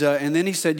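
ordinary talk, nothing marked

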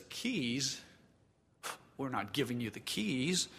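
dealer's refusal. Acted. Dealer refuses the prize keys.

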